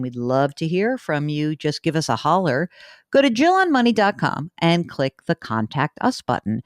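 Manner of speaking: usual speaking voice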